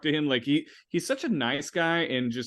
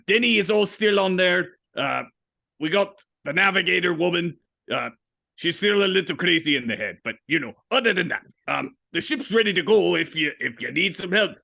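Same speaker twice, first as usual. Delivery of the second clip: lightly voiced pirate captain; old pirate captain; 6 semitones higher, 6 dB louder than usual. Old captain offering his crew, in pirate voice. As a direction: play an easygoing old sea captain.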